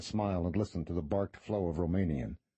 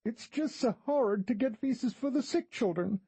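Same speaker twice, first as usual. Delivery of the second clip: near tears. A narrator's reading. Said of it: "on the verge of tears".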